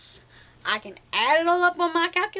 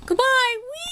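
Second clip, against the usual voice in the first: very high singsong goodbye; playful squeal; 10 semitones higher than usual. Pretend. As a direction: squeal as if on a spinning ride. Pretend farewell while spinning viewers around.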